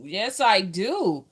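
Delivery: ordinary talk, nothing marked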